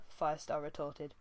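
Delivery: ordinary delivery, nothing marked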